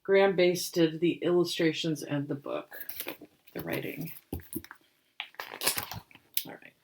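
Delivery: ordinary delivery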